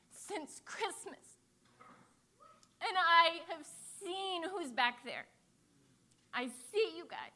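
rally the church to action; fervent preaching. Real, not acted. Pastor urging the congregation to welcome kids, preaching.

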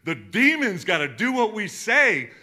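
loud, high, thrilled report; excited disciples. Retold or imitated. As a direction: tell him like you can't believe it. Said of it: "They're like".

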